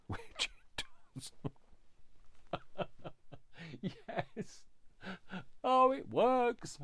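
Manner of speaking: high squealing tearful laughter; real happy tears breaking into laughter; crying with happiness. Real, not acted. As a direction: laugh and cry with joy, pitch soaring. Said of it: "I'm crying with happiness".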